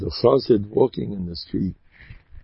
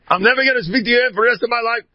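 high, fast mock-furious yell; angry yelling; 12 semitones higher, much faster than usual. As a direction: burst out in a sudden retold tirade. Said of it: "And he yelled at her".

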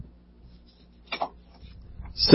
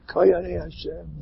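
much softer, slow and reverent; a slow, hushed reverent murmur; hushed awe; 4 semitones higher, 9 dB quieter, slower than usual. Acted. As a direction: breathe it in awe. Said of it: "The awe".

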